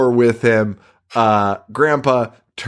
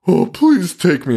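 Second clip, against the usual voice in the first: higher, slow mock plea; pleading grandpa; 6 semitones higher than usual. Grandpa begging Herman to take him, mock pleading. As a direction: beg to be brought along. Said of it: "And Grandpa's pleading".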